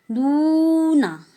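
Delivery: teacher modelling Korean "नूना"; Korean word. Shown as a demonstration. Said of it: "उसको बोलेंगे नूना".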